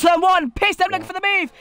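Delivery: hyped-up play-by-play; excited commentary. Acted. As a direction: call the move as it happens. Live race call.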